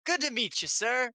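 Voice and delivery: high, eager polite greeting; Oliver greeting. Acted. Oliver eagerly greeting the tiger noble, pitched high.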